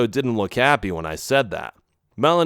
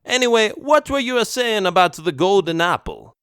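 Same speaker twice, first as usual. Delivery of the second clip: put-on higher mob boss greeting in a thick Italian accent; 9 semitones higher than usual. Vincenzo welcomes visitors, thick Italian accent. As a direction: roll it out like an Italian don. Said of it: "thick Italian accent".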